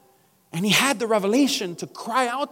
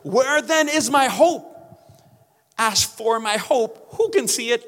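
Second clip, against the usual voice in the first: Job losing hope; 4 semitones higher, 4 dB louder, slower than usual. Retold or imitated. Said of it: "this is another case of Job losing it".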